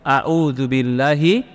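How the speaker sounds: slow, measured Arabic recitation. Retold or imitated. The Prophet teaching the angry man, slow recitation. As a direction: recite it slowly and reverently. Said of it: "kata Rasulullah".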